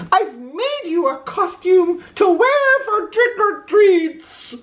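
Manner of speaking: very high, sugary mother voice; doting mother. A narrator's reading. Mother greeting Oscar with his costume, high and gushing. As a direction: gush over him lovingly. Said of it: "said Oscar's mother".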